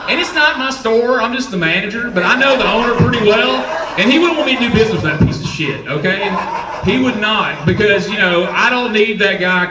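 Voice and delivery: loud laughing rant, pitch up. Real, not acted. Comedian to audience, ranting loudly while laughing.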